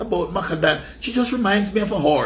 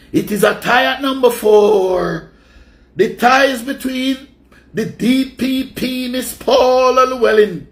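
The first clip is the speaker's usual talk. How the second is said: drawn-out louder host voice counting down the list; countdown announcer; 3 semitones higher, 5 dB louder, slower than usual. Acted. Host announcing the next entry on his list, slow and booming. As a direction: announce it like a chart show host, stretching the words.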